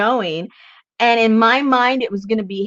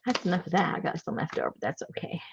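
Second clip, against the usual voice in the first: very soft, low half-whisper; talking to herself; 5 semitones lower, 13 dB quieter, faster than usual. Real, not acted. Crafter murmuring to herself over leftovers, hushed. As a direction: let it fade away to yourself. Muttering to herself while working.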